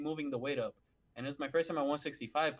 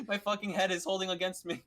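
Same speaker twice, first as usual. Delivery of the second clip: ordinary delivery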